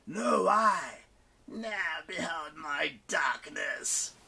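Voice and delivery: slow, fading demon boast. Acted. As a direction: boast slowly into softness. Demon voiced.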